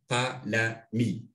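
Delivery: qalami drawn out slowly, each syllable clear; slow model of the word; slow teaching demo. Shown as a demonstration. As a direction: let the learner hear every sound of qalami. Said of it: "Agrego i al final".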